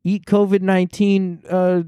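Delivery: high hammed-up Trump; Trump impression. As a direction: riff like a hacky Trump bit. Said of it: "hacky type of bits".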